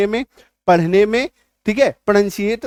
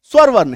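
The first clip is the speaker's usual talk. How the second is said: स्वर वर्ण said louder and crisp; emphasised term; 6 dB louder than usual. Shown as a demonstration. Teacher stressing the Hindi term. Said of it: "इनको हम लोग कहते हैं".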